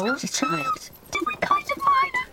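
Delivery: very high, soft squeak; squeaky high voice. Acted. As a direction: pitch it way up like a tiny person. Mimicking gnome in high voice.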